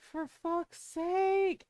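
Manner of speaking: slow, drawn-out weary sigh of words; fed up and done with it; fed up with the screaming neighbor, exasperated. Retold or imitated.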